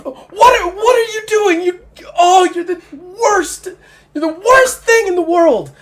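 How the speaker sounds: high, loud furious cry; NPC woman shouting. Acted. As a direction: shriek at him in pain and fury. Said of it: "she's like".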